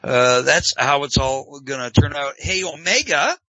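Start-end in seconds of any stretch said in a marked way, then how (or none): none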